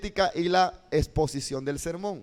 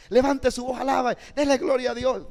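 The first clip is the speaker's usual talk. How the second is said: high, urging praise call; rally the congregation, voice lifted high; rousing preacher's call; 7 semitones higher, 3 dB louder than usual. Shown as a demonstration. Teacher models calling a congregation to praise, high and rousing. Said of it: "es una expresión corporal".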